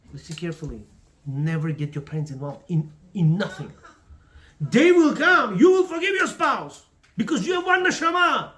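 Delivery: ordinary talk, nothing marked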